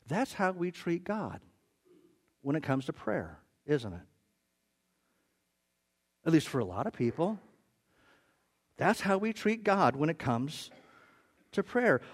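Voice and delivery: ordinary talk, nothing marked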